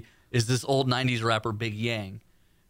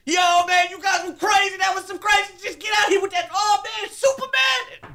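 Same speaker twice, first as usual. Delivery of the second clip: loud, high shouting hype voice; Big Yang's call; 3 dB louder than usual. Retold or imitated. Host imitating a radio owner's hyped-up shouting call. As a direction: yell it like an excited rapper on the phone. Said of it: "And I would pick, and I".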